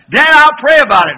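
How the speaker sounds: put-on loud, high-pitched boy's excuse; mock your son dodging chores, high and loud; imitated son. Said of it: "he looked at me and said".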